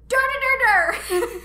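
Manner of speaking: put-on high, loud furious baby shrieks; hammed-up toddler tantrum cry; mad baby yell. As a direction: scream like a red-faced angry infant. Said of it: "she gets so mad".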